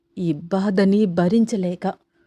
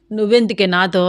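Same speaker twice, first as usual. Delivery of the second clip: plain reading voice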